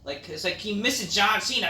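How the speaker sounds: usual speaking voice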